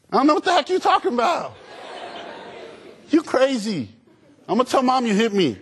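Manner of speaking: put-on high-pitched indignant child's comeback; defiant kid. Son talking back to his dad, defiant high-pitched kid. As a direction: play a mouthy kid squealing back at a parent.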